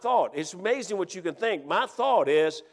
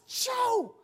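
high, strained hushed call; urgent stage whisper; 3 dB quieter than usual. Retold or imitated. Hunter alerts buddy to the buck, urgent hiss. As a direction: force the name out high without full volume.

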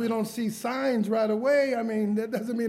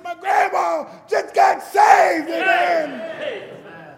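much higher, louder slow joyful shout; excited shout; 12 semitones higher, 10 dB louder, much slower than usual. Retold or imitated. Student shouts to dorm mates his grandma got saved. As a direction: yell it down the hallway, overjoyed. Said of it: "I said".

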